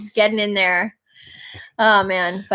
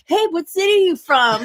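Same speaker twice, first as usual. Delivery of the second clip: higher, lightly put-on dad voice; imitated chatty dad; 7 semitones higher than usual. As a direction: play a dad eagerly making small talk. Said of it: "my dad was, like, wanting to chat".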